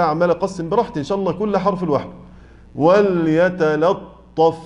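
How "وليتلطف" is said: وليتلطف very slow with a thin lam; correct light lam. Shown as a demonstration. Teacher modelling the word correctly. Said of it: "بس جت صحيحة".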